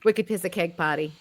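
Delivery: put-on broad Boston accent, no r's in party; Boston accent on request. Asked to repeat it, in a broad Boston accent. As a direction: say it again like a true Boston kid. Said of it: "Could you do that again?".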